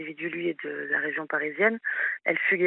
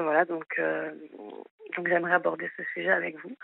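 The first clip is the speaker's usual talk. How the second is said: ordinary talk, nothing marked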